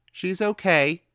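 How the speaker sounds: put-on high, loud young boy; child's voice. A narrator's reading. Boy calling his answer through the door, high and loud. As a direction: voice a small boy calling back. Boy's voice in the story.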